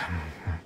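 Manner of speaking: a quiet defeated sigh; faint hopeless exhale; soft sigh. Acted. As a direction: let the breath drop away. Merchant trailing off in despair.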